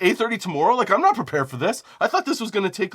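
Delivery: high, disbelieving question, caught off guard; incredulous. Retold or imitated. Retold phone call, confused reply.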